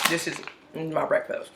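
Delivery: chewing while talking; mouth full. Real, not acted. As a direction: speak through a mouthful. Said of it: "I'm eating breakfast right now".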